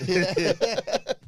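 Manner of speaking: laughing while saying it. Real, not acted. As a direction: chuckle through the line. Laughing at joke.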